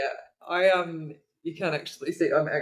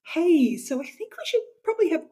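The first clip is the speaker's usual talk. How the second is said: chirpy; 5 dB louder than usual. Retold or imitated. Friend quoted inviting to coffee.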